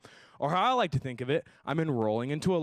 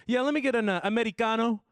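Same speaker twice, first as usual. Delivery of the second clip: put-on higher, louder posh-kid voice, saying Americano as Amedicano; a higher snooty rich kid voice; 8 semitones higher, 3 dB louder than usual. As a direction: play the entitled rich kid ordering. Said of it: "he said".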